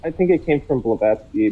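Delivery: ordinary talk, nothing marked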